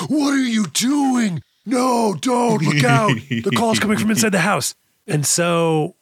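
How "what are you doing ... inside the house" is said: high, hammed-up mock alarm; panicked audience. Audience warning the hero about the gun, mock panicked shouting. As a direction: shout a warning at the movie screen. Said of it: "audience has to be like".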